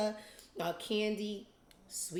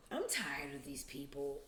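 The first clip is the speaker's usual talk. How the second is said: whispered, weary aside; tired breathy confession; 6 semitones lower, 9 dB quieter than usual. Real, not acted. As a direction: whisper it like you're done with them. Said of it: "whispered aside".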